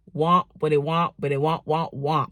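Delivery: mock the teacher's muffled wah; lightly done trombone wah; Charlie Brown teacher wah. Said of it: "That's what the teacher always sound like".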